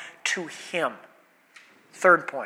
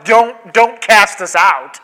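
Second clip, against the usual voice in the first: louder acted objection; mock protest; 7 dB louder, slower than usual. Demons imagined arguing with Jesus, loud mock protest. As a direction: object loudly, as if arguing back.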